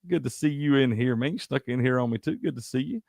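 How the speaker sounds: ordinary talk, nothing marked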